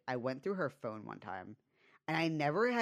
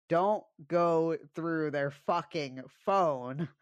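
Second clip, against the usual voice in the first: very slow, louder, word by word; dead-serious warning; 4 semitones higher, 6 dB louder, much slower than usual. Real, not acted. Warning listeners from her own experience, slow and emphatic. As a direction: drive each word home slowly, pitched up. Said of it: "I'm so fucking serious".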